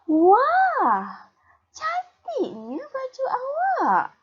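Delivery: very high, loud wah then a slow, stretched gush; admiring exclamation. Shown as a demonstration. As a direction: marvel at the shirt, drawing it out. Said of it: "Cikgu ulang lagi sekali".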